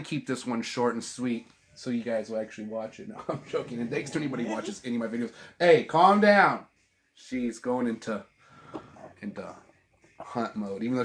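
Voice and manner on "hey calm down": loud, slow, drawn-out hey to settle the dog; firm call to dog. Real, not acted. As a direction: call the dog down firmly and slowly. Calming the excited dog.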